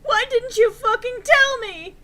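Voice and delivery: over-the-top outraged shout. A narrator's reading. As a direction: yell it at her, betrayed. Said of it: "he shouted".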